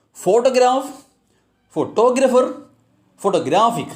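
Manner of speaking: "Photograph, photographer, photographic" stressed on first, second, then third syllable; shifting word stress. Shown as a demonstration. Teacher giving another example of suffixes, shifting word stress. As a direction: move the punch along: PHOtograph, phoTOgrapher, photoGRAphic. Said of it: "will really affect the stress".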